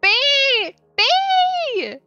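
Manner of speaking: high, piercing scream of alarm; screaming child. A narrator's reading. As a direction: shriek the word, very high and loud, drawn out. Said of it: "began to scream again".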